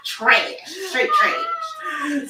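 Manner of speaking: cracking up on the word; laughing through a verdict. Real, not acted. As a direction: straight trash said through laughter. Laughing while judging.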